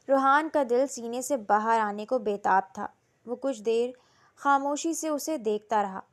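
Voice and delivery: ordinary delivery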